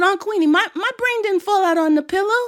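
put-on high, plaintive little kid; protesting child. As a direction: object up high like a small child.

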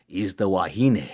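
ordinary delivery, nothing marked

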